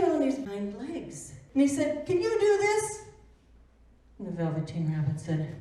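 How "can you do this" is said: taunting bunny. Acted. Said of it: "kept taunting him".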